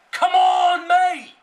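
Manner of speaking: high, very loud drawn-out yell; impatient shout. Real, not acted. Gamer short of recording time, impatient shout. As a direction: holler at the opponent to hurry.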